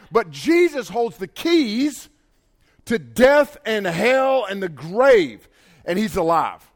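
slow, loud preaching build; full-force preaching. Acted. Preacher declaring to the congregation, slow and loud. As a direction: preach it out, hitting every phrase. Preaching Jesus holds the keys.

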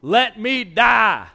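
loud, high, slow wail; despairing plea. Acted. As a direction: drag it out as if begging to die. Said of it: "he asked".

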